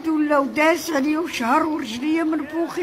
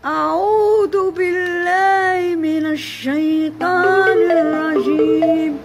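slow, drawn-out chanted recitation; Quran recitation; 2 semitones higher, 3 dB louder, much slower than usual. Shown as a demonstration. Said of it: "انا ندير واحد المقطع".

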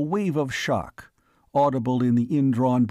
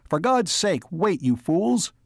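raised, high pleading yell; urgent yell; 5 semitones higher than usual. A narrator's reading. Man shielding the alien from gunmen, yelling urgently. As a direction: plead with them at a high pitch. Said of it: "he yelled".

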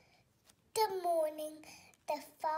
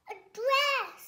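high, louder eager demand; 7 semitones higher, 8 dB louder than usual. Acted. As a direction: call out for a dress. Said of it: "said the middle sister".

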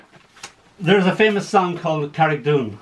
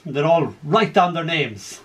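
ordinary talk

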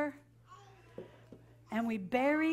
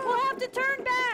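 high, loud urgent cry; 10 semitones higher, 5 dB louder than usual. Acted. Pirate urging the crew to give up, urgent cry. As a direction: call it out in alarm. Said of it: "Somebody say that".

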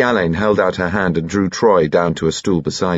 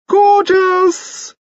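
loud falsetto squeal of delight; very high-pitched cry; 5 dB louder than usual. A narrator's reading. Excited lord shouts over the band, very high-pitched. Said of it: "pitching his voice very high".

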